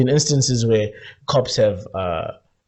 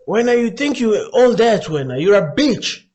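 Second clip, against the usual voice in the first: cop's remark; 8 semitones higher, slower than usual. Retold or imitated. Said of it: "I hear a cop say".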